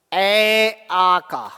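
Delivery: ए आ stretched very slowly, each vowel held long; drawn-out vowel demo. Shown as a demonstration. Teacher demonstrating to students: very slow drawn-out ए आ. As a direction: hold ए then आ, slowly, so the class hears the throat sound. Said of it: "जब आप उच्चारण करते हैं".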